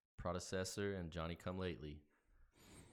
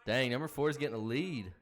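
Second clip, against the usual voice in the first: excited; 5 semitones higher, 6 dB louder than usual. Real, not acted. Watching race.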